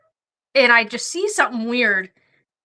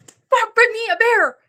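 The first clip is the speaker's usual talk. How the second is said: higher, urgent call to partner; excited alarm; 10 semitones higher than usual. Retold or imitated. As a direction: blurt it out the instant you see the bear. Spotting a bear suddenly.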